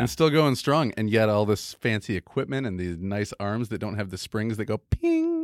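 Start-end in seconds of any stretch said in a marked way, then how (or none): 4.8-5.4 much higher squeaky ping. Pretend. Imitates spring noise.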